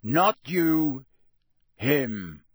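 loud growled correction; forceful gruff growl. Acted. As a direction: growl it out impatiently. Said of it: "Moody growled".

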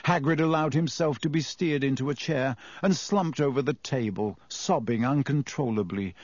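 plain reading voice

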